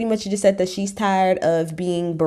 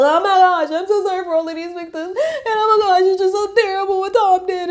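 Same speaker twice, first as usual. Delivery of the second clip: mock weepy apology; 11 semitones higher than usual. Mocking what critics want [name] to say, much higher, hammed-up tearful apology. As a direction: ham up a tearful, over-the-top apology. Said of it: "get on my knees and bawl and cry and be like".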